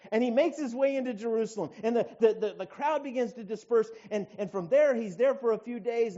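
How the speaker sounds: loud, high-pitched sermon delivery; forceful preaching. Real, not acted. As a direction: preach it out with conviction.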